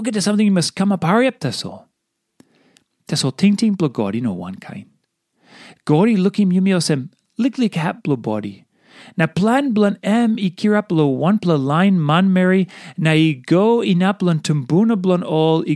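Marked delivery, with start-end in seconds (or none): none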